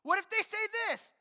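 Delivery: a mock objector raising his pitch; questioning objector. Objector versus God's answer.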